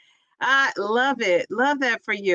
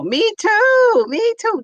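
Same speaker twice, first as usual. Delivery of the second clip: excited; 11 semitones higher, 5 dB louder, slower than usual. Real, not acted. Eager agreement.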